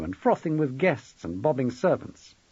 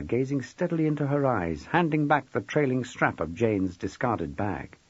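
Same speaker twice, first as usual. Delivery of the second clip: plain reading voice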